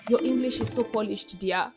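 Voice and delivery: lightly voiced gushing admirer, a touch posh; a hint of a fawning commenter praising her accent; mock gushing fan. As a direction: coo it like a flattered fan. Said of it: "you guys would be like".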